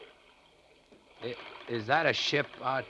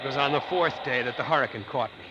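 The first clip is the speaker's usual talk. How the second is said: louder and louder, voice rising as the waves hit; shouting over storm; 6 semitones higher, 6 dB louder than usual. Acted. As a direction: raise your voice as if the hurricane is roaring around you. Letter describes hurricane.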